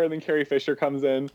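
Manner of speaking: ordinary delivery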